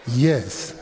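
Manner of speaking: lightly voiced calm clerk, slow; a hint of an unbothered clerk. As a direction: ask it slow and patient. African clerk asking.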